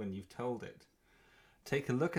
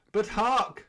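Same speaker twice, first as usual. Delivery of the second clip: hammy stage actor; 6 semitones higher, 4 dB louder, slower than usual. Pretend. Announcing the crow's arrival.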